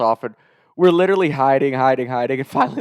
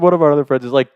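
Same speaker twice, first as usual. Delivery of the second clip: ordinary delivery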